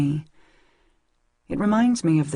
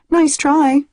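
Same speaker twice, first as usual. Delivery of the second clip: teasing; 11 semitones higher, 7 dB louder than usual. A narrator's reading. Teasing while hiding brush.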